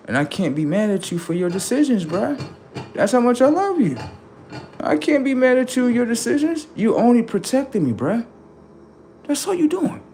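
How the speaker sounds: high, strained heartfelt plea; moved, pleading reassurance; emotional. Retold or imitated. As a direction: voice rising with feeling.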